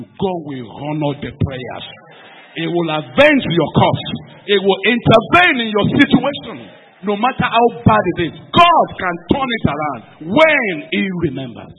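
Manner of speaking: very loud, rousing pulpit declaration; full-force preaching. Real, not acted. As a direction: thunder the promise out to the congregation.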